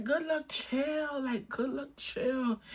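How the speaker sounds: soft, slow mock "good luck, chill"; retelling a hushed, slow brush-off to a pushy client. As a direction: wave him off softly and slowly. Brushing off his touching.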